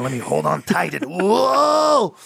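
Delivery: cling on and yelp, overdone; mock treadmill panic.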